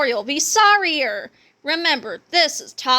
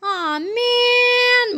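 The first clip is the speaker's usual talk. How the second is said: put-on high, whiny childish groan; 11 semitones higher than usual. A narrator's reading. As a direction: drag it out like disappointed kids.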